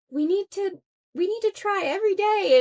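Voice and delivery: hammed-up type A planner; mock type-A urgency. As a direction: play her own control-freak self, high and loud. Type A self insisting.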